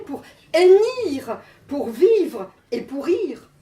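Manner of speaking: emphatic, rhythmic poetry performance in short phrases; poem recital. Acted. Poet reads her self-portrait to the audience, emphatic recital. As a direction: declaim it phrase by phrase, punching key words.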